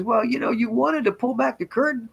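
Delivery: high-pitched imitation of an amused woman; amused friend, retold. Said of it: "she starts laughing again".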